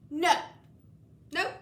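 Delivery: ordinary delivery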